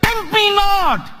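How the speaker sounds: mustering courage to rebuke the devil; commanding shout. A narrator's reading. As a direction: command Satan at full voice. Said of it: "he mustered his courage and he said".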